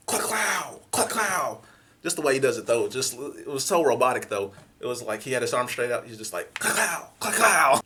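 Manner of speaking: sharp mouth-made gunshot; gunshot sound effect. Retold or imitated. Acting out the stranger firing robotically, gunshot noise. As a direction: pop off the shot with your mouth.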